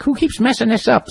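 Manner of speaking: high-pitched mock exasperation; exasperated grandmother. Grandmother demanding who moved her rug, mock exasperated. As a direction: imitate a cross grandmother, pitched well up.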